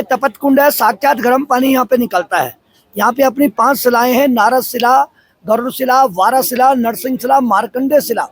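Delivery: ऊँची, तेज़ आवाज़ में चिल्लाकर बताना; भीड़ के ऊपर पूरी ताक़त से आवाज़ लगाओ; ज़ोर से पुकारते हुए. Real, not acted. Guide shouting over crowd noise. (भीड़ को समझाते हुए गाइड का ऊँचा, ज़ोरदार स्वर)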